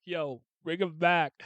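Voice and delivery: loud, excited plea to bring him back; getting excited. Real, not acted. Fan pleading for a favourite's return, getting excited. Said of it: "I'm getting excited".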